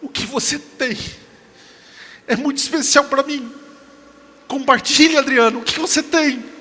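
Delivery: high, loud, insistent appeal; acted earnest plea. Woman begs the preacher to share his faith, acted earnest plea. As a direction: plead urgently, retelling her request.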